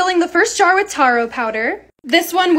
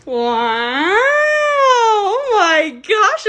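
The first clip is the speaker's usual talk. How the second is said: awed gasp; 6 semitones higher, much slower than usual. Real, not acted. Admiring the finished bar.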